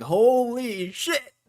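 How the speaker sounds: high, slow drawn-out amazement; mock crowd gasping holy shit; amazed crowd. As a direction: stretch it out in awe, up high. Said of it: "everyone starts coming in like".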